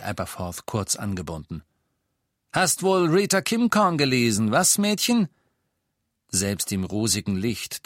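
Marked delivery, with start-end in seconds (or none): 2.5-5.3 höher und lauter, höhnisch; spöttisch. Acted. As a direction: das Mädchen bloßstellen. Aberforth curtly mocks Hermine. (Aberforth verspottet Hermine)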